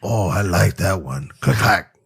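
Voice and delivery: drawn-out, relishing actor impression; Al Pacino. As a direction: savor it, then mimic the clack. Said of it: "talking like that while I'm".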